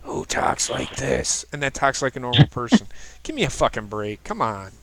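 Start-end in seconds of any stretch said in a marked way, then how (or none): 0.0-1.4 slow, raspy mock superhero growl. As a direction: rasp it out slowly, mocking the voice.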